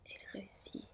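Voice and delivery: usual speaking voice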